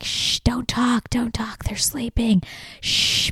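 hushed, urgent shushing; imitated shushing whisper. Telling others the kids are sleeping, shushing whisper. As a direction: shush them in an acted whisper. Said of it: "I'm completely quiet".

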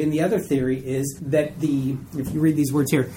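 normal talking voice

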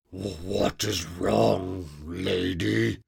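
slow, softened monster voice; the monster asking in sudden confusion; puzzled monster. Acted.

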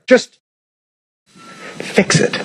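breathy whispered fix it; hushed, desperate little plea. Acted. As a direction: plead under your breath. Pleading voice of the sinner.

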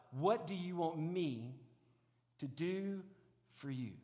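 slow, fading gentle question, What do you want, at arm's length; tender, close. Retold or imitated. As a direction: ask it softly, knee to knee, holding hands. Jesus asks face to face.

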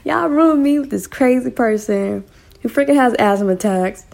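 imagined roommate. Retold or imitated. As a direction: squeal it as the freaked-out roommate. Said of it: "I know she was like".

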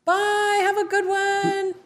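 high, slow singsong farewell; drawn-out high-pitched bye; calling goodbye. Real, not acted. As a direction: call it out high to a departing coworker. Calling goodbye to departing co-worker.

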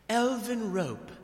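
a slow, lightly voiced elf queen; drawn-out gift announcement, a hint of elf queen; Galadriel. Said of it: "she's like".

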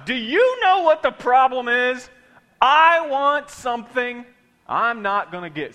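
loud, slow sassy delivery; with stank. Shown as a demonstration. Preacher practises the line with congregation, sassy and loud. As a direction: give it real stank. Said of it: "say it with some stank on it".

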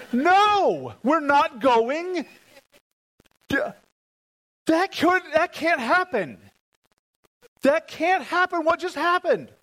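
high, loud, stunned protest; can't believe the boat just started; shocked disbelief. Retold or imitated. Preacher reliving his disbelief when the dead engine started, high and stunned. Retold panicked disbelief, much higher.

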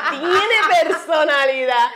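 slow, laughing repeat; amused, laughing through it. Real, not acted. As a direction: laugh it out fondly. Laughing, repeating about son.

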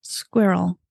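Squirrel said skwi-rel with the I heard; two-syllable squirrel. Shown as a demonstration. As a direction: say Squirrel with a clear I sound. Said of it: "there's an I in it".

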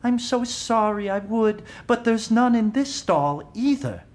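a polite woman in the next stall, sorry she can't help; apologetic stranger. Retold or imitated. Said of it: "the woman in the next stall says".

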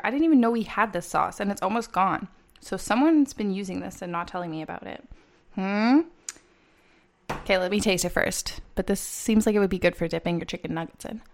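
normal talking voice

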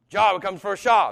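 "Jah comes before Shah" said high and loud, corrected; corrected mnemonic. Shown as a demonstration. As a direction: punch the fixed order of "Jah" and "Shah". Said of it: "There you go, yeah".

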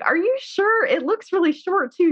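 doubtful wife. Retold or imitated. Imitating the bewildered wife questioning her husband's story. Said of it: "she's just like".

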